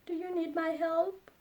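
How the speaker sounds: high rising "Do you need my help?". Shown as a demonstration. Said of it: "repeated model phrase".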